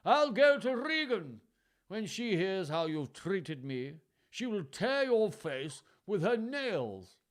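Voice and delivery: high, slow, hammed-up threat; mild mock rage; angry threat. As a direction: threaten her with slow menace. Lear threatens Goneril.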